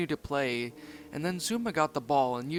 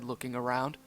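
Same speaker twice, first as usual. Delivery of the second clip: ordinary delivery, nothing marked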